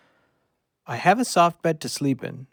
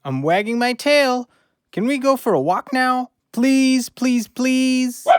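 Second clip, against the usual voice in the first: higher, louder pleading for a walk; eager begging; 9 semitones higher, 4 dB louder than usual. Acted. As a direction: beg like an excited puppy. Dog begs eagerly for walk.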